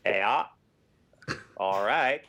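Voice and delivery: lightly put-on Maine accent; a stab at a Maine accent. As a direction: try a drawn-out Maine "ayuh". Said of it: "that's Maine you're thinking of".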